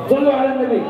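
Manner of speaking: high ringing call to the crowd; preacher's call. Real, not acted. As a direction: lift the room with the blessing. Preacher calls congregation to blessing.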